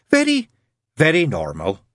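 first Very high and loud, then dropping; emphatic VERY. A narrator's reading. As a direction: punch the first "Very" high, settle on "very normal". Nun insists the baby is normal.

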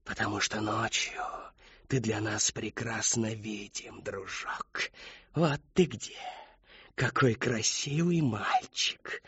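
hushed, slow leer; lightly voiced menacing grin; leering Whisperer. A narrator's reading. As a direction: grin through the words. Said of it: "Та осклабилась".

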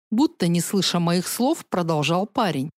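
ordinary delivery

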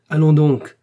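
high, loud command; authoritative. A narrator's reading. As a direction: overrule him with full authority. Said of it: "dit-elle avec une telle autorité".

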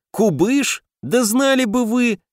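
high, mocking outburst; scornful exclamation. A narrator's reading. Guard Grum scoffs at Kubysh's fame, high mocking exclaim. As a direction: scoff high like you know better. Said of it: "зло хохотнул".

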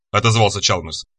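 plain reading voice

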